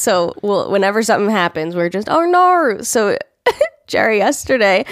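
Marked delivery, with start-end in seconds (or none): 2.1-2.8 high, dramatic Australian "Oh, nar"; Australian accent. Shown as a demonstration. Reacting to anything, in a hammed-up Australian accent. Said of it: "we love accents".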